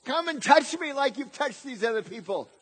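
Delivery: high, loud acted plea; imitating a desperate beggar's shout; crying for mercy. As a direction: beg urgently, calling out over others. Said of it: "he was crying for mercy".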